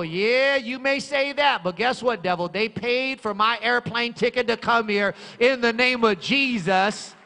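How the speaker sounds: loud, high, fervent preacher's cry; shouting praise from the pulpit; full-force preaching. Acted. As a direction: preach it out to the whole church. Preacher rebuking devil, rising.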